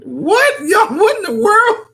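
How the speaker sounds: very high-pitched, laughing disbelief; real astonishment, voice shooting way up; incredulous surprise. Real, not acted. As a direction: squeal it, amused and baffled. Reacts to chat calling voice sexy.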